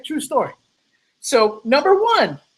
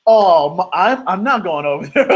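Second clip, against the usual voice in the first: laughing refusal. Retold or imitated. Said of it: "I'm like".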